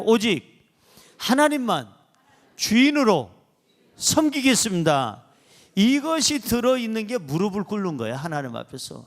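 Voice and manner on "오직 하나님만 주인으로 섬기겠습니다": loud, phrase-by-phrase declaration; lead a repetition. Shown as a demonstration. Pastor leading congregation in a vow, firm declaration. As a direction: proclaim each phrase and pause for the echo. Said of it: "따라할까요".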